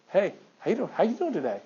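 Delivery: casual greeting. Retold or imitated. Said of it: "What did I just say?".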